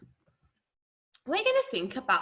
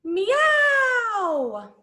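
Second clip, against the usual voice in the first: long, swooping high meow; loud drawn-out cat call; 10 semitones higher, 4 dB louder than usual. Shown as a demonstration. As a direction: mew like a cat, rising then falling. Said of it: "Can you make a cat sound?".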